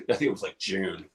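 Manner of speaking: ordinary delivery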